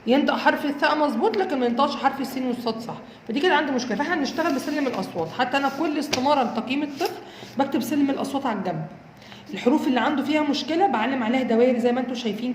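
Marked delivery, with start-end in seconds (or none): none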